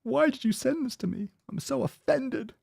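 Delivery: high-pitched, hammed-up hurt voice; mock offended. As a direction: whine it like a caricature of wounded feelings. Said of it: "They're gonna be like".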